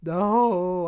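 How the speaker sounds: loud, drawn-out no; a big cry of dismay. Real, not acted. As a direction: wail it in protest. Player dreads the bad ending.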